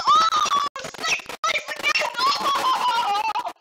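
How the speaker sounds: high, loud wail at losing; dismayed scream. Real, not acted. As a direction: wail over a bad finish. Loses places at finish line.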